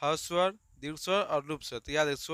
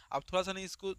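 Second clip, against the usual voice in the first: ordinary talk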